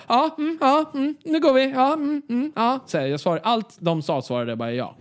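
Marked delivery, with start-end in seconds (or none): 0.1-2.8 high, absent-minded ja, mm; distracted yes-yes. To new friends, mock absent ja-mm replies. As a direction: nod along without listening. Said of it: "Och jag bara".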